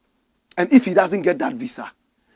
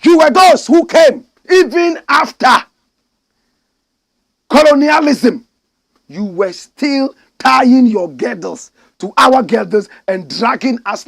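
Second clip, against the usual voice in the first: furious shout; 8 semitones higher, 11 dB louder than usual. Real, not acted. Angry accusation at colonisers.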